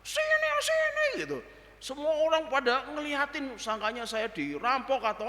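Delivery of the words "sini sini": very high, loud calling; shouting. Retold or imitated. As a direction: call the driver back urgently. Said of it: "Saya teriak".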